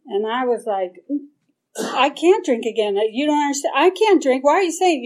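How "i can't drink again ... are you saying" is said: rising, louder panicky pleading; retelling her frantic reply to the sponsor, pitch climbing; panicked protest. Retold or imitated. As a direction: protest louder and higher each time, spun out. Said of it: "I got really spun out about it".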